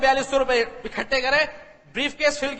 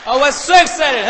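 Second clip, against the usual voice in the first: shouted, slow, exultant exclamation; excited; 3 semitones higher, 9 dB louder, much slower than usual. Retold or imitated. Repeating his joy at joining Amway, shouted excitement. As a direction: proclaim the thrill at full voice.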